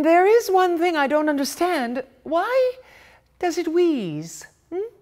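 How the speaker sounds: the wife setting her husband straight; bossy mother. Acted. Mother voiced in read story.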